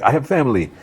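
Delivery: high, mock-frightened plea; pleading bank man. Bank man told to open the vault, high and pleading.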